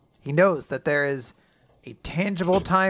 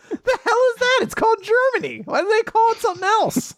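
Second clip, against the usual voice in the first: very high, louder comic bafflement; mock outrage; 4 dB louder than usual. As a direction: squawk it in hammed-up indignation. Acting the boy baffled by Deutschland.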